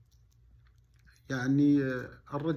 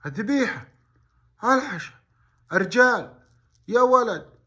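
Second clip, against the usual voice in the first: panicked outcry; 9 semitones higher, 10 dB louder than usual. Retold or imitated. Boy panics over missing feast.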